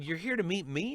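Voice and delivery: high, incredulous acted question; surprised question. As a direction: act taken aback, voice jumping up. Acting out his surprise at kid.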